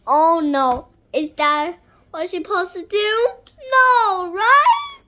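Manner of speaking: high, loud, rising to a laugh; loud high worried question ending in a laugh; excited worry. Real, not acted. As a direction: fret loudly then crack up.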